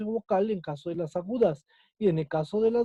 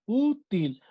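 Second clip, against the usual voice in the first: "Útil" with a raised, stretched ú; stress on the U. Shown as a demonstration. Teacher confirming the tonic syllable, "Útil" with stressed ú. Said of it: "es ahí donde está la sílaba tónica".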